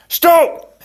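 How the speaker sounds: high, loud call to halt; urgent shout. A narrator's reading. Inigo calling Fezzik back on the stairs, urgent shout. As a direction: yell it sharply to halt someone.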